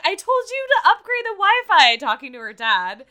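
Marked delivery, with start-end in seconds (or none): 0.0-2.0 Alexis voice. Retold or imitated. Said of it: "Alexis is like".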